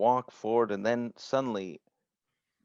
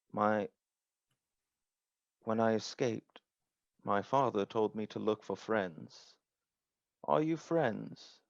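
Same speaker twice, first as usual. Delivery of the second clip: halting, innocent android voice; understated, tentative robot seeking friends; lightly voiced childlike android; 4 dB quieter than usual. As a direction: speak softly in separated phrases, ending on a hopeful question. Game master voices the android.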